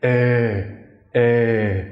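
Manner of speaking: "Eh" held long from the back of the throat; emphasized throaty "Eh", no nasality; throaty vowel. Shown as a demonstration. Teacher shows the E with the hat, throaty vowel. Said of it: "much more throaty sound".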